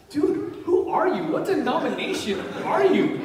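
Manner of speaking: a high, surprised "who are you?"; amazed question. Retold or imitated. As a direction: imitate a baffled atheist. The atheist asking, amazed.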